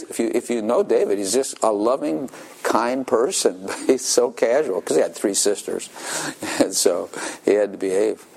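ordinary delivery

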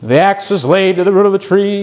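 mock harsh doomsday preacher; high, forceful sour warning; John the Baptist. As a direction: cry it out like a stern prophet. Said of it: "sour-faced John".

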